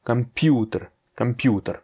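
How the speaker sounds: "Computer" twice with PU punched, falling after; stress on U. Shown as a demonstration. Teacher pointing out the accented vowel, stress on PU in "Computer". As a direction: hit the "pu" in each "computer". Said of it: "j'accentue sur le U".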